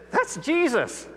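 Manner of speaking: a high, hushed "That's Jesus", falling on "That's"; stunned as it dawns on him; awed realization. Retold or imitated. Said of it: "he says".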